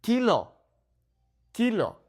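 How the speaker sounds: Kilo as KEE-loh, high, twice; Spanish "Kilo" with pure vowels; Spanish pronunciation. Shown as a demonstration. Teacher models vowels, high Spanish Kilo. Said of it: "focus on the vowel sounds".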